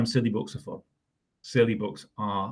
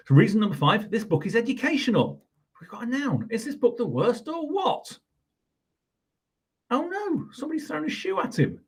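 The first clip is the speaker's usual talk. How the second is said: much higher, lively storybook voice; teacher reading a silly book to kids; dramatic read-aloud; 11 semitones higher than usual. Acted. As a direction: read it with big mock drama. Reads the book's reasons.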